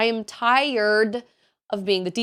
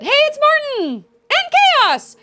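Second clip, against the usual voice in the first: high, loud, delighted hello to each name; light up as you call out each viewer; excited greeting; 10 dB louder, slower than usual. Real, not acted. Streamer greeting chat viewers by name, thrilled and much higher.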